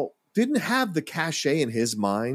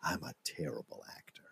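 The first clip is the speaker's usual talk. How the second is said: Malkovich confiding; 7 semitones lower, 16 dB quieter than usual. Retold or imitated. Actor confesses to his co-star on set, in a hushed whisper. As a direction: whisper it as a deadpan aside. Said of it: "Malkovich looks at Damon and he goes".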